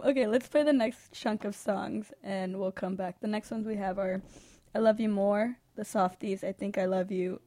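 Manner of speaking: ordinary talk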